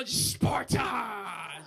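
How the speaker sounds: slow, drawn-out movie battle shout; mock Sparta shout. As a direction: bellow it like a Spartan king.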